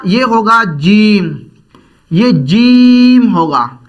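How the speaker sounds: जीम drawn out slowly with a clear j; tongue middle to palate for जीम; slow model reading; careful jeem. Shown as a demonstration. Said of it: "जबान का ये बीच ऊपर के तालू से लगेगा".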